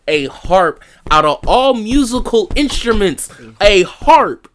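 loud, high disbelief; rising outrage at a harp; incredulous. Real, not acted. Mocking the harp kill.

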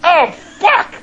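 high, loud mimicked curse; sudden high yelp of frustration; retold frustrated outburst. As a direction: blurt it like a rejected suitor. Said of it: "They went".

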